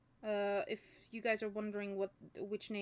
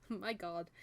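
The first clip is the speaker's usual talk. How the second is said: laughing; 2 semitones lower, 6 dB quieter than usual. Real, not acted. Reader laughing at misreading, amused and soft. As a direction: laugh quietly at your own slip.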